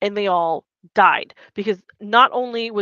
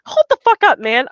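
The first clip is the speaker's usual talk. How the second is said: indignant outburst; 7 semitones higher, 7 dB louder than usual. Retold or imitated. Leia snaps back at senator.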